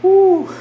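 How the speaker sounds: a high, loud whew, still winded; breathless whew. Real, not acted. Lifter on a livestream catching his breath, loud high whew. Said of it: "I need to catch my breath".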